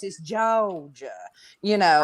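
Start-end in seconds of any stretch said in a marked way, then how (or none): 0.2-1.1 "Georgia" drawn out slowly with no R; slow, R-less Southern "Georgia"; Georgia without R's. Shown as a demonstration. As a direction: drop the R in "Georgia", drawling it. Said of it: "you gotta leave out the R's".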